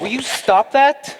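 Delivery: sharp, loud and drawn out; annoyed outburst. Real, not acted. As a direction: snap at him to stop. Irritated at interruption.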